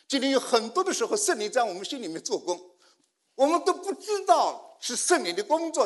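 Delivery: high, loud, stretched-out exhortation; forceful preaching. Acted. Preacher exhorts the congregation about the Spirit's work, forcefully.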